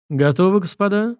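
smiling. Acted. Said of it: "с улыбкой спросил Минин".

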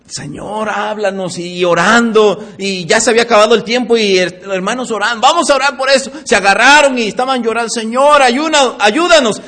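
a hint of crying out to God; imitating fervent praying. Brothers crying out to the Lord in prayer, mock fervent.

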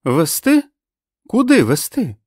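high, loud incredulous questioning; cold challenge. A narrator's reading. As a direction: demand an answer, pitch jumping up. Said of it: "холодно спитала Френ". (холодно й різко, високим голосом)

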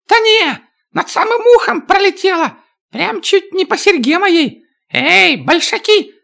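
high, rattled little dwarf; excited dwarf voice. Acted. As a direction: babble it high, shaken. Dwarf reacts to shot.

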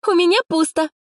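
put-on very high, girlish rejoicing; delighted. A narrator's reading. Friend relieved at her empty lot, delighted. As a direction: pipe up high with glee. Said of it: "радовалась рядом Алина".

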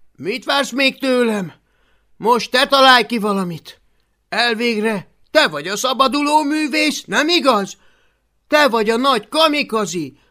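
high, loud frantic demand; frantic boy shouting at his friend. Acted. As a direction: yell at her to do something. Boy shouting at girl in storm.

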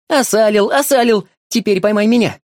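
high loud gleeful cries; playful shouting. A narrator's reading. Boy tags the dog and runs, gleeful shout. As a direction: shout with glee in the game.

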